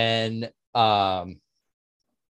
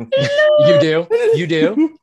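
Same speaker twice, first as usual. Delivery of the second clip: laughing; 10 dB louder, slower than usual. Real, not acted. Laughing reply, very high.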